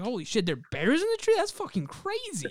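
mock amazement. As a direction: voice shooting up high, slowing on the end. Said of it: "my brother's like".

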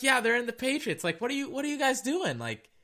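high, exasperated disbelief; high incredulous hello; incredulous retort. Retold or imitated. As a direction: throw it back at them, high and baffled. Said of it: "I'm like".

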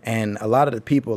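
ordinary talk, nothing marked

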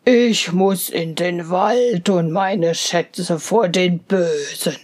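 low bear voice, hushed and grave; a bear warning about thieving dwarfs; serious bear. Acted.

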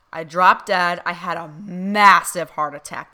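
loud, blunt declaration of sudden death; emphatic loud quote. Retold or imitated. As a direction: blurt it out loudly like a medium relaying a shout. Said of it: "That's how she said it".

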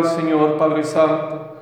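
ordinary delivery

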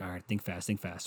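quiet urgent pep talk to yourself; retold, hushed "think fast"; muttered to self. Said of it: "So I, I'm like".

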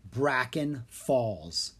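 plain reading voice

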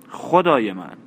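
mildly astonished outburst; astonished exclamation. A narrator's reading. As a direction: exclaim it in mild amazement. Annie reacts to the gold coins.

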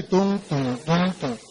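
low, soft "tum, tum" like a heartbeat; imitated drumbeat. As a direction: tap out a slow drumbeat with the voice. (imitando un latido, grave y suave)

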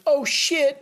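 high-pitched comic alarm; exaggerated startled outburst; mock startled nurse. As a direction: imitate a nurse yelping in shock.

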